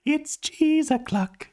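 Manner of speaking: high, very slow mock announcement; silly jingle voice.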